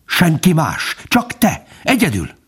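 high, pointed reproach; single out the lone traitor; hissing accusation. A narrator's reading. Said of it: "sziszegte a róka". (vádlón sziszegve)

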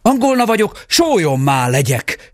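high magic cry; calling out. A narrator's reading. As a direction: cry the spell to escape. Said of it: "kiáltott a fiú".

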